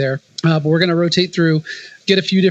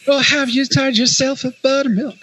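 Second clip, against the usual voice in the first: folksy radio host; 8 semitones higher than usual. Pretend. Continuing hammy folksy bit.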